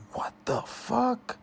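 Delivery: a shocked whispered curse; stunned whisper. Real, not acted. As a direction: breathe it out in disbelief, high and hushed. Stunned reaction to fight stats.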